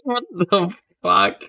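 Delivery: slow, high-pitched stunned reaction; dumbfounded, words spaced apart; real disbelief. Real, not acted. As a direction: draw it out as the punchline sinks in. Listener reacts to the punchline.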